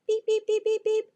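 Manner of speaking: high, even electronic beeps; quick high beep sounds; imitated phone beeps. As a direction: mimic dialling tones in a squeaky pitch.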